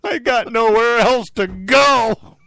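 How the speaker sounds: high, slow, hammed-up wail; mock self-pity. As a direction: plead helplessly like an abandoned husband, for laughs. Comic self-pity over sad dinner.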